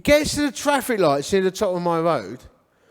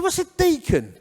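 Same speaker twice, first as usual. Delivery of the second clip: put-on much higher, incredulous teen query; mock bewilderment; baffled questioning; 10 semitones higher than usual. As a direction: pitch it up in comic confusion. Teenager baffled by church title.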